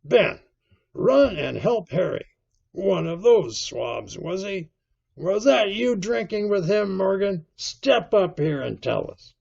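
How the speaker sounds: loud, high landlord barking orders; indignant cry. A narrator's reading. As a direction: take command of the room, outraged. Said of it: "cried Silver".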